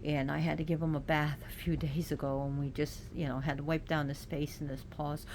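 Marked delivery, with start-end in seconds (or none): none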